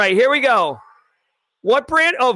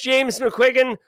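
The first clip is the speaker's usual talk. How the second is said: normal talking voice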